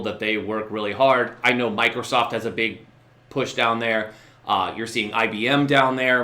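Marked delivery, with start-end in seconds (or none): none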